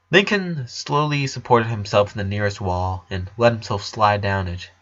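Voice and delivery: ordinary delivery, nothing marked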